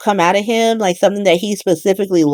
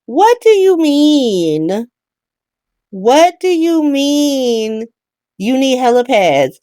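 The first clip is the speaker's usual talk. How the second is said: very slow, higher "what do you mean"; incredulous; 6 semitones higher, much slower than usual. Real, not acted. Recapper reacting to the councilman's demand, slow disbelief. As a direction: drag it out in disbelief. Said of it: "incredulous at helipad demand".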